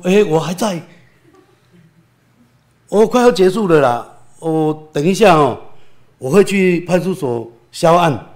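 retelling his relieved call to the police station; relieved phone call. Retold or imitated. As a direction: loudly reassure the police he is fine. Phoning police, still alive.

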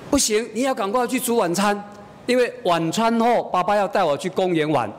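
lightly voiced little girl making demands; insistent child. Small daughter pressing mother to cook, insistent child.

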